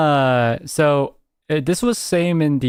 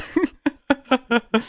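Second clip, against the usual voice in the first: high giggle; 10 semitones higher than usual. Real, not acted. Giggling at own impression.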